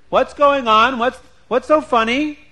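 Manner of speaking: loud, high, curious wife asking; demanding to know. Acted. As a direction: break in with loud, high questions.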